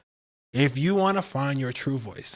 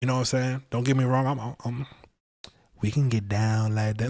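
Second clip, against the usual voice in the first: ordinary talk, nothing marked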